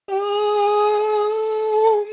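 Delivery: long, high-pitched panicky hum; nervous stalling. Acted. Bobby stalls when mom asks if he's okay, high nervous drone. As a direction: freeze on one high held note.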